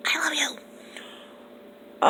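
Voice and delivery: breathy slow whisper; soft whisper. Real, not acted. Whispering love to a fellow YouTube teacher. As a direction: whisper it like a secret.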